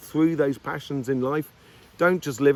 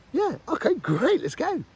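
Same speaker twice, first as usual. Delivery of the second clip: much higher, cheerful agreeing voice; imitated happy yes; 12 semitones higher than usual. The other person agreeing to the meal, higher and cheerful. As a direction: accept the date with a raised, eager voice.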